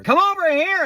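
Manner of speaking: high-pitched mock call; imitated high holler; calling over. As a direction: summon helpers from across the room. Trainer calls colleagues over.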